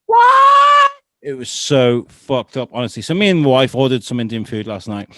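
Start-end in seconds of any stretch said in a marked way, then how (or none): 0.1-0.9 a sudden high, loud shout of surprise; a real yelp of surprise; startled shout. Real, not acted. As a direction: blurt it out, caught off guard. Sudden outburst mid-story.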